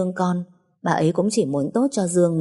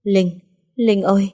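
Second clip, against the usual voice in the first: choked voice; 3 semitones higher, slower than usual. A narrator's reading. Mother-in-law tearfully calls her daughter-in-law, choked. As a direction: voice catching with tears. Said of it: "Giọng bà ấy nghẹn ngào".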